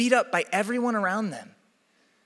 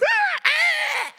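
high squawking whinny; piercing imitated animal-like shriek; rooster-horse shriek. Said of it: "combination between a rooster and a horse neighing".

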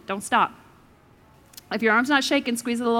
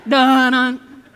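mock dramatic sting; 8 dB louder than usual. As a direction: hit it like an ominous drumbeat. Dramatic sting sound effect.

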